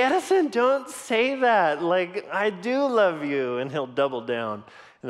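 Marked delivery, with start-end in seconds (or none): 0.0-3.6 pleading dad. Retold or imitated.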